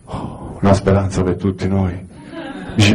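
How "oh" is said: ordinary talk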